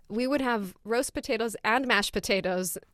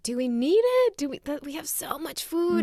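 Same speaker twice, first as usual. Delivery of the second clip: higher-pitched pleading objection; incredulous protest; 9 semitones higher than usual. Retold or imitated. Daughter questioning mom about extra rice, incredulous protest. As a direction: question the need, pitched well up.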